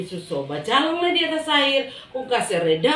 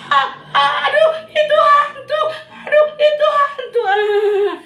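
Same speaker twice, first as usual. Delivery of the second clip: very high, loud panicked screaming; half-laughing panicked yell; terrified shriek; 8 dB louder, slower than usual. Acted. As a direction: scream like you've seen a ghost. Disciples seeing a ghost.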